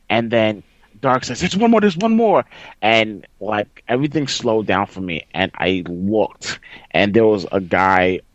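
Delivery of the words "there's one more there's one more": high-pitched teammate warning; urgent warning. Retold or imitated. Teammate warns of one more opponent, urgent callout. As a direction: imitate an urgent callout over game chat.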